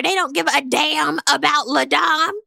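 ordinary talk

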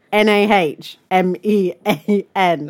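letter by letter, slow; spelled out slowly. Shown as a demonstration. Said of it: "messaging her every minute".

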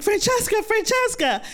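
very high urgent calling; high frantic call from the kitchen. Retold or imitated. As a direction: call her name in alarm. Calling friend as she collapses.

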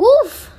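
high, loud winded oof; squeezed yelp; leprechaun oof. Acted. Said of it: "cried the leprechaun".

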